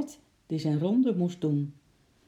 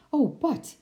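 high, worried exclamation; anxious hailing; 10 semitones higher, 4 dB louder than usual. A narrator's reading. Mother running to meet Bart, anxious. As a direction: call out in worry to someone arriving.

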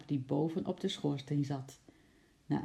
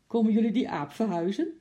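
loud, higher jolly call; cheerful question; 6 semitones higher, 6 dB louder, faster than usual. A narrator's reading. Baker jokes to the removal men, cheerfully loud. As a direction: ask it as a merry joke. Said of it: "vroeg de bakker vrolijk".

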